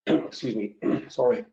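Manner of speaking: throat clearing, a bit husky; frog in throat. Real, not acted. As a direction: clear the throat, then speak. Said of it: "little frog in my throat there".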